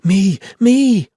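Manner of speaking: put-on very high, loud little girl's cry; eager child holler. A narrator's reading. Little Rosie begging to carry the hatchet, high loud holler. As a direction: shout it with your hand up in the air. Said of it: "Rosie hollered".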